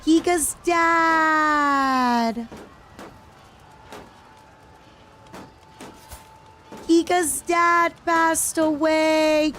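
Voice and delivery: long-drawn, high theatrical wail; high, slow mock lament. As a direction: stretch the words out in hammed-up grief. Wailing over a game death.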